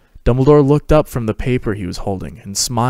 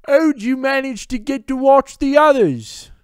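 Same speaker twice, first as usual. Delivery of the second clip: put-on much higher, louder young man; Fabian; 4 dB louder than usual. A narrator's reading. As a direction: voice a cheeky twin. Fabian asks.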